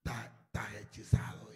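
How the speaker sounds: eerie unvoiced whisper; ominous whisper; whispered warning. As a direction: whisper it like a spell.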